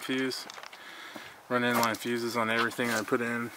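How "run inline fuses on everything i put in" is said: ordinary delivery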